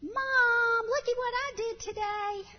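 put-on high, loud proud boy; boy calls mom to show his find, high and loud; excited kid. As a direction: shout it proudly like a child. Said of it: "hardest things I had to hear".

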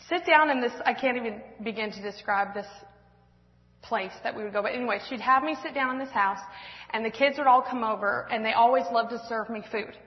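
ordinary delivery

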